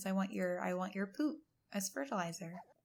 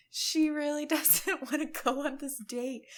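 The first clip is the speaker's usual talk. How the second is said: louder, words broken by a laugh; 6 semitones higher, 6 dB louder than usual. Real, not acted. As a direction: giggle through the line. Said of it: "this is so hilarious".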